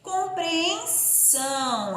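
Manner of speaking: Compreensão stretched slowly, every nasal vowel ringing; teacher models a fully nasal word. Shown as a demonstration. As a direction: spell it out by sound. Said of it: "uma palavra totalmente nasalada".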